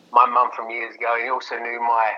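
usual speaking voice

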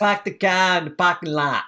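put-on broad, playful Boston accent; mock Boston accent. Joking with co-host about Boston speech, mock Boston accent. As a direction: imitate a Bostonian, dropping the r's. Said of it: "hate mail from somebody from Boston".

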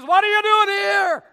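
loud, high, demanding; God's question shouted at the congregation. Acted. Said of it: "God's question to you this morning is".

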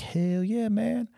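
high, hyped hell yeah; pumped-up yes. Retold or imitated. Soldier to first sergeant, high hyped agreement. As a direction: jump at the offer, all in. Accepting sergeant's offer to drink.

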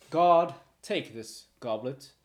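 loud, slow command to the guard; commanding order. Acted. Lord Archibald orders his guard, loud and slow.

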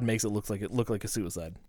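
normal talking voice

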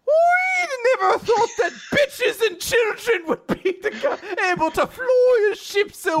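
very high, loud, slow Irish squawk; hammed-up Irish shout with laughter; Captain Connor. Captain meets the ship's captain, astonished mock Irish.

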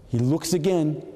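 ordinary talk, nothing marked

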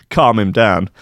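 ordinary talk, nothing marked

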